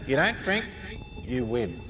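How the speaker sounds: the elf's words haunting him; echoing memory. Retold or imitated. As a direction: let the remembered voice fade away. Recalled voice at the liquor store.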